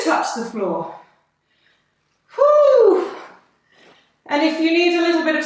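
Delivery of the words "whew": high, loud whew of effort; breathy whew. Real, not acted. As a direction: blow out hard like the set is tough. Tired breath out mid-workout.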